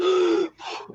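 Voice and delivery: sharp startled gasp. Retold or imitated. Aunt realising it's pot, sharp gasp. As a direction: suck in air in alarm. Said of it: "And I went".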